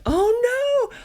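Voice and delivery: sudden high, loud alarmed cry; a mother's panic at seeing her child hurt; mom dread. Retold or imitated. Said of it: "that moment of mom dread".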